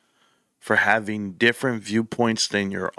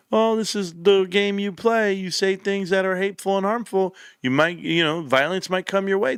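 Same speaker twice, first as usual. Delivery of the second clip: higher, glib mock of a dismissive host; 7 semitones higher than usual. Mimicking TV hosts brushing off violence, mocking. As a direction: sneer it like a smug pundit. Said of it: "T V hosts are like".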